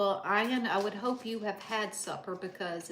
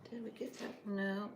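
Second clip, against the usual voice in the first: normal talking voice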